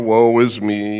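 mopey low Eeyore impression. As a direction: sigh it out like gloomy Eeyore. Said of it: "like Eeyore".

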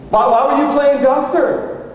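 very high, stammered why; puzzled father. Retold or imitated. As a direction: imitate a baffled dad questioning his child. Father probes daughter.

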